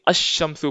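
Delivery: अश-शम्सु straight into a doubled sh; silent L. Shown as a demonstration. Teacher explains omitting laam, अश-शम्सु with long sh. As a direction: leave out the L in अश-शम्सु. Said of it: "silent कर देंगे और directly".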